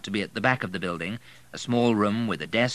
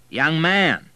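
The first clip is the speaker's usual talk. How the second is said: a little louder; 8 semitones higher, 8 dB louder than usual. A narrator's reading. General calling a young man across the room, louder. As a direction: raise the voice to get attention. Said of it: "a little more loudly".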